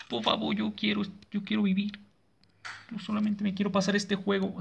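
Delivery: high, softened begging; small, high, nervous plea; pitiful pleading. Real, not acted. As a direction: plead for your life in a small voice. Pleads to survive the game.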